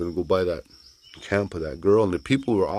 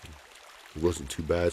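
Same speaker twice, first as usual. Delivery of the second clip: usual speaking voice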